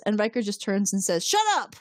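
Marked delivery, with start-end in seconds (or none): 1.3-1.7 high-pitched mock snap; angry retort. Host voices Riker silencing Picard, angry. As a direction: cut someone off with a high, acted outburst.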